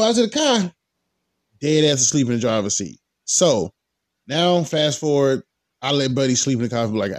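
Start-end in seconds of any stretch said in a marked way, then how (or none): none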